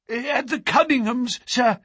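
high, agitated servant's voice; gasped. A narrator's reading. Butler breaking bad news to his master, gasping. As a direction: blurt it out in alarm. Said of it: "he gasped".

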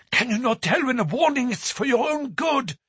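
high pleading warning; urgent cry. A narrator's reading. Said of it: "she cried".

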